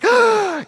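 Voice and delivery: a sudden high gasp, like breaking the surface; sharp gasp for air. Shown as a demonstration. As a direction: gasp as if you just came up from under a wave. Surfacing from the washing machine.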